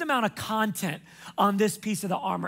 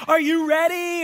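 higher, louder game-show host build-up; TV host hype; 7 semitones higher, 5 dB louder than usual. Retold or imitated. Pastor imitating the makeover host teasing the family, hyped. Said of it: "and they're like".

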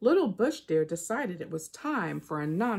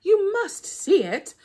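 much higher, louder, slowed angry insistence; losing patience now, push hard; high, loud, slow exasperated trickster; angry Anansi; 13 semitones higher, 7 dB louder, slower than usual. Acted. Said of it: "Anansi began to get angry".

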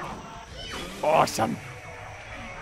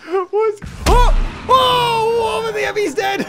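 shocked shout; 11 semitones higher, 5 dB louder, slower than usual. Real, not acted. Sudden kill in game.

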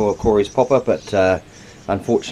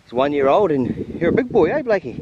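pet talk; 7 semitones higher than usual. Real, not acted. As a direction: coo at the pet deer. Talking to pet deer.

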